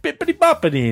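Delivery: high mock Italian singsong; fake Italian accent. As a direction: toss off a fake Italian phrase. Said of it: "on that episode where I was like".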